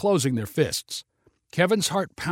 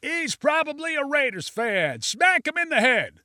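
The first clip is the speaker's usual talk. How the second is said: heckler voice; 12 semitones higher than usual. Acted. Crowd member egging on.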